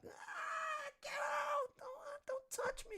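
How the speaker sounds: put-on very high, slow crying shout from a terrified child; imitating child crying. Streamer acts out the girl screaming in the video, high crying shout. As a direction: wail it like a sobbing ten-year-old. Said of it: "when you hear ten-year-olds cry".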